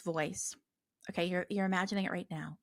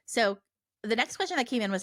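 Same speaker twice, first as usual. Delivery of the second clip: usual speaking voice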